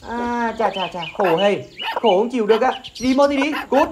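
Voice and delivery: husband jeers at wife and shouts get out; mocking shout. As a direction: scoff at her, then bark her out. Husband mocks wife then shouts.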